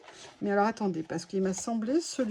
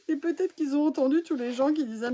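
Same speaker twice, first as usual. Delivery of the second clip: usual speaking voice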